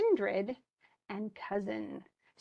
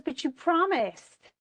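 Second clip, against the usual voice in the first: higher, slow, hurt reminder; pleading reproach; 6 semitones higher, slower than usual. Retold or imitated. Teacher voicing Everyman reminding Fellowship of his promise, slow and hurt. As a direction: drag it out like a let-down friend.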